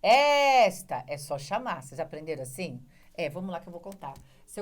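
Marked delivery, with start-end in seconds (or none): 0.0-1.0 "Esta" called loud and high, ES stretched long; stressed syllable called out. Shown as a demonstration. Teacher showing which sound is stronger, long loud ES. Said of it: "Qual é mais forte?".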